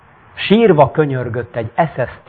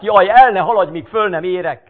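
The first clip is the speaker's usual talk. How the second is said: higher, loud anguished call; crippled man shouting from the roof; 7 semitones higher, 3 dB louder than usual. Acted. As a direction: shout in desperation. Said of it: "és kiabált".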